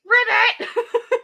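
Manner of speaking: high, loud frog shouts; giddy, squealed frog impression; imitated chat ribbits. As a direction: shout mock frog calls through laughter. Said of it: "you guys are all just like".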